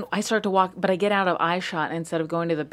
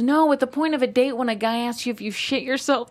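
sister's advice; 6 semitones higher than usual. Retold or imitated. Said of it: "And she goes".

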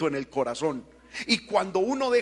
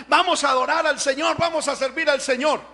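mock eager declaration, higher and louder; eager cry; 6 semitones higher, 5 dB louder than usual. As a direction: voice the keen people setting out. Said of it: "decían". (el pueblo entusiasmado, proclamación alta)

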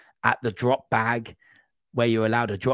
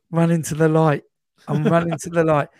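high, loud, drawn-level chant-like call; raised voice, playfully overdramatic; mock dramatic cry; 6 semitones higher, 7 dB louder than usual. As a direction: call it out like a hammed-up film line. Mock cry while retelling night running.

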